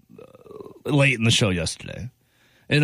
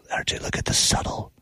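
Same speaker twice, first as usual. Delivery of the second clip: whisper; 5 dB quieter than usual. Real, not acted. Marvelling at jersey detail.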